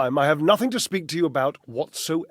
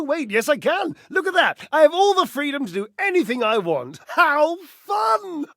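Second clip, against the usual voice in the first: high, giddy mockery with a laugh; mock giddy glee; 10 semitones higher than usual. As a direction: toss it off as if suddenly free and delighted. Mock carefree outburst, laugh.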